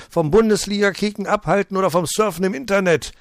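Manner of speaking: angry rant. Acted. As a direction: harangue the director. Residents rant at director. (empörte Tirade)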